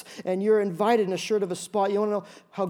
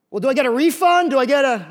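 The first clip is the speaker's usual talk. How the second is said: loud, higher, desperate plea; pleading; 6 semitones higher, 8 dB louder than usual. Retold or imitated. Pastor replaying his plea for a refund, loud and high. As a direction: beg for the refund, hammed up.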